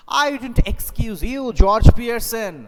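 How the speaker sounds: high, loud and slow rebuke; wife scolding husband firmly; indignant wife. Shown as a demonstration. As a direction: scold the husband by full name. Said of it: "the wife is telling".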